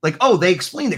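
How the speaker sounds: ordinary talk, nothing marked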